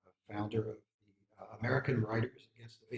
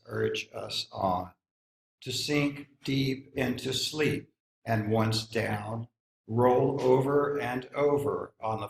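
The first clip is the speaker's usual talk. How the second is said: measured, slow-opening recital, a little louder; poetry reading; 7 dB louder, slower than usual. Acted. Reading Winter Nights to the gathered audience, steady poetry recital. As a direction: read the poem with weight, letting the lines breathe. Reads Robert Bly poem aloud.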